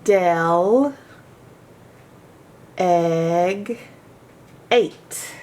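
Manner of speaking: Del, egg, eight split into slow separate syllables. Shown as a demonstration. Teacher sounding out "Del egg eight" for the class. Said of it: "makes its short sound eh in del".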